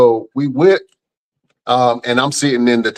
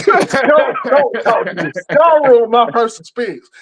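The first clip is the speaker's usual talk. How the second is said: high, exasperated don't talk to me; mock offended, high and laughing; indignant retort; 10 semitones higher than usual. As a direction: snap back with a laugh, pitched up. Annoyed retort to cop.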